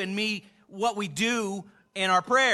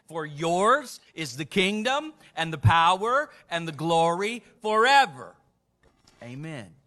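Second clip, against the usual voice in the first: recited prayer ending; 5 dB louder than usual. Acted.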